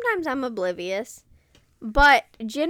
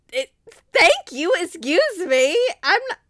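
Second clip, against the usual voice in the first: higher, louder, pointed thanks turning to threat; passive-aggressive thanks; 5 semitones higher, 5 dB louder than usual. Retold or imitated. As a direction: thank them loudly to shame them. Door holder ignored.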